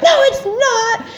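very high, drawn-out contradiction; acting out a shrill wail of refusal; screaming. Patient rejecting partner's reassurance, screaming. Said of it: "but I'm screaming at her".

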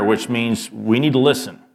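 ordinary talk

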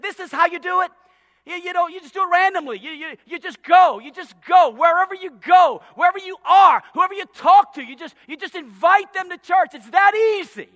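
loud, high, driving sermon voice; full-force preaching. Acted. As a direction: preach it out to the whole room. Preaching to the church.

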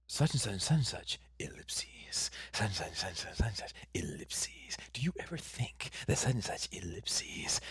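hushed read-aloud, near whisper on ellipses; imitating Robert D. Co-host mimicking a podcaster reading out a question, hushed. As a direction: read it softly under your breath, mimicking him. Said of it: "Robert D was like".

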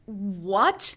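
loud, incredulous rising outburst; retold disbelief. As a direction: re-enact being floored by bad news. Retells shock at factory closing.

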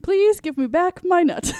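high squeaky squirrel voice; rubbery high squeaky toy. Retold or imitated. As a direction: squeak like a tiny cartoon squirrel. Voicing the squirrel from the movie.

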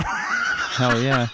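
laughing shout. Real, not acted. Laughing cheer at spaghetti week.

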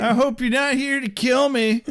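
high, small pretend voice; timidly sing-song, hammed up; mock nervous greeting. Greeting a possible killer, high and mock nervous.

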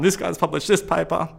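mock him for his live audience; mocking blogger voice. Calling out the psychiatrist on a Facebook live blog, mocking. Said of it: "did this in my live blog on Facebook".

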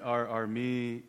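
ordinary delivery

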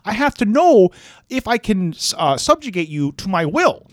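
high, fast mock Jordan Peterson voice; Jordan Peterson impression. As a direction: lecture it in a parody Jordan Peterson voice. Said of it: "you sounded like George Bailey".

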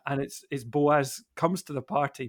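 ordinary delivery

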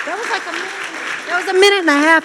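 high, amused disbelief; realizing her testimony ran long, high and amused; surprised laugh. Real, not acted. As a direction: blurt it out high and amazed. Surprised at her own long talk.

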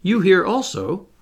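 high-pitched astonished query; surprised cry. A narrator's reading. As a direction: exclaim at an unexpected meeting. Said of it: "cried Barbicane".

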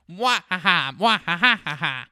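loud mwah-ha-ha evil cackle. Pretend. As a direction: cackle like a cartoon villain. Accused of bad influence, cackles.

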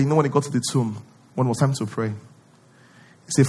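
normal talking voice

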